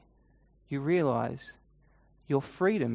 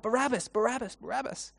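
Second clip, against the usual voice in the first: high, held-back mock cries; high chant without full volume; crowd crying out; 8 semitones higher than usual. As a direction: imitate a distant crowd chanting, fading off. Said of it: "The crowd keeps crying out".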